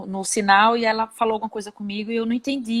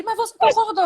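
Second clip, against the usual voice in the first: high, startled disbelief; intern's baffled question, pitched up high; incredulous question; 11 semitones higher than usual. Retold or imitated. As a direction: ask it amazed she just agreed. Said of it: "Aí ele".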